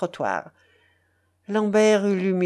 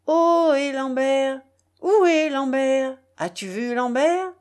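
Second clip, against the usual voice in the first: wild street cry; 5 semitones higher than usual. A narrator's reading. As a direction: call out high and sustained, rising at the end. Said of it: "son cri sauvage retentissait".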